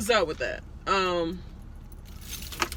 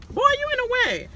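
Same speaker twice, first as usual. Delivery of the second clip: sudden high yell at someone nearby; shouted aside. Real, not acted. Yelling at someone blocking the view, high shout. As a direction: snap at someone in the way.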